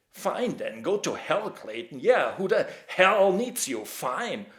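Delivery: put-on slow, bitter drunken shouting; nasty shouting. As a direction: yell him off like a resentful drunk. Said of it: "Harry and Roberts turned nasty".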